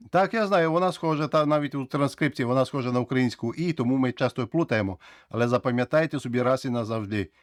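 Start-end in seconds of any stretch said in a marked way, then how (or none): none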